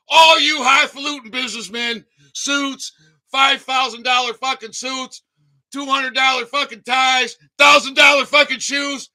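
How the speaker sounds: loud, building tirade against the suits; angry rant. Real, not acted. Addressing the smart people watching: a furious, building rant. Ranting at educated elites on stream.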